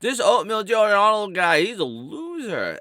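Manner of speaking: high-pitched mock of an online hater. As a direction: jeer it like a gossiping troll. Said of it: "you can go around saying".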